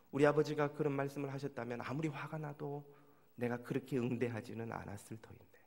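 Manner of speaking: ordinary delivery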